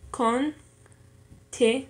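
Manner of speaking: slow "continuo" in three pieces, con, ti, nuo; syllable by syllable. Shown as a demonstration. Learner dividing a word for an exercise, "continuo" syllable by syllable. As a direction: break "continuo" up, keeping "nuo" as one syllable. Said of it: "con y ti".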